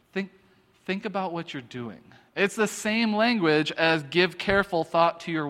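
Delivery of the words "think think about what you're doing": quiet, halting nudge to reflect; admonishing parent. Acted. Urging people to take stock, hushed admonishing. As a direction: drop your voice, pause after "think". Parent's phrase acted once more.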